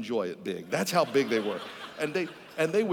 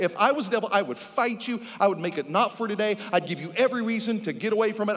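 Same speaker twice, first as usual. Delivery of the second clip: raised, urgent, driving sermon voice; preaching at full force; 7 semitones higher, 6 dB louder than usual. Real, not acted. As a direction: press the warning on them insistently.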